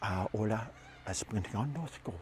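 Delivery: very soft, low whisper into an ear; hushed fake language; whispered gibberish. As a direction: murmur nonsense words close to his ear. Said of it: "she whispers in his ear".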